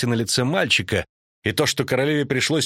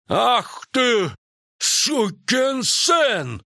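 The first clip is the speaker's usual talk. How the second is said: high, drawn-out furious shout; sudden outraged bellow; Крахенкрайт roaring; 12 semitones higher, much slower than usual. A narrator's reading. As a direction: explode in rage, stretching each word. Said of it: "неожиданно рявкнул Крахенкрайт".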